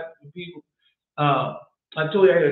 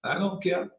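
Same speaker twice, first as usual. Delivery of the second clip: ordinary delivery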